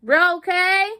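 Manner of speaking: high, loud, caring check-in; calling out. Real, not acted. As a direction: call out to see they're okay. Checking on sick family.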